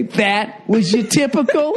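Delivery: put-on high, slow Staten Island accent. Couple repeating their running joke, mock Staten Island accent. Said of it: "with that accent".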